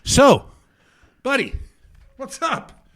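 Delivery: retold needling of a heckler; pointed confrontation. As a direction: size him up, one word at a time. Confronting the man who stayed.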